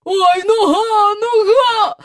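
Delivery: high crying wail over her leg. A narrator's reading. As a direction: wail the pain out, voice breaking. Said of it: "лементувала покоївка Елін".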